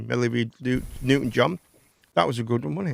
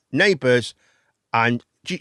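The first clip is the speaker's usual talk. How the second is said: normal talking voice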